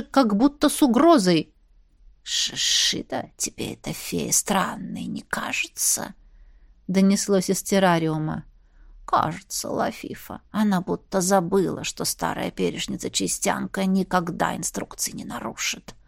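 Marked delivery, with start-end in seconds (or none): 2.2-6.1 slow hissing snake whisper. Acted. 9.1-15.9 low hissing snake voice; snake whisper. Acted. Second snake answers.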